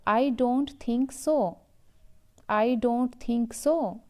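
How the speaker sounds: slow, clear teacher delivery; slow model sentence. Shown as a demonstration. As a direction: say it slowly for learners to repeat. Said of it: "The first sentence is". (धीरे और साफ़ बोलकर दिखाना)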